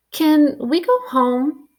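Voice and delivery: high, slow little voice; small child voice. Acted. Embarrassed baby asking his parents, high and slow. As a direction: ask it like a worn-out toddler. Tired baby after spacewalk.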